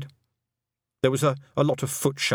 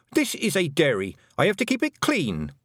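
higher, indignant telling-off; angry scolding; 8 semitones higher than usual. A narrator's reading. Girl defending her dairy, angry. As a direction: scold them crossly, pitch climbing. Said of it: "shaking with anger".

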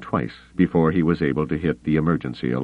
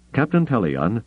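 urgent, higher call; 6 semitones higher than usual. Acted. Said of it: "called urgently".

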